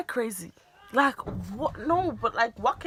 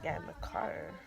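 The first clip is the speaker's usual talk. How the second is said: much lower, soft repeated question; low disbelieving echo; 12 dB quieter than usual. Retold or imitated. Daughter echoing her mother, low and soft.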